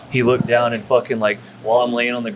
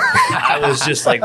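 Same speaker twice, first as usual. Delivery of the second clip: laughing; slower than usual. Real, not acted. Laughs while recalling his stunned shock. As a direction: burst out laughing mid-sentence.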